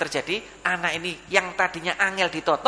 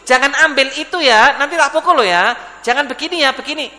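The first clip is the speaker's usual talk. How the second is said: raised, louder mock threat; scolding parent; 6 semitones higher, 7 dB louder than usual. Parent warns a child off with a threat, loud mock scolding. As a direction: imitate a parent laying down the law.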